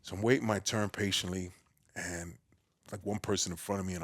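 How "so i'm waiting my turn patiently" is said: ordinary talk, nothing marked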